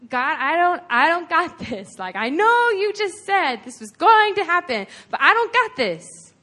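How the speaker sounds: acted exasperated plea; pleading protest. Acted protest of inadequacy to God.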